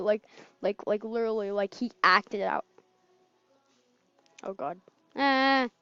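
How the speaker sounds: usual speaking voice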